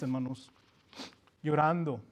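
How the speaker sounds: normal talking voice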